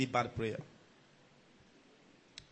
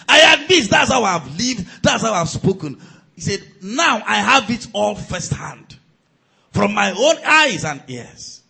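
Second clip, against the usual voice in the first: Job's loud confession; 5 semitones higher, 11 dB louder than usual. Acted. Preacher voicing Job's confession.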